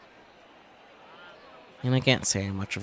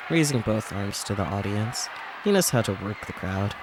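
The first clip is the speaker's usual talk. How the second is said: usual speaking voice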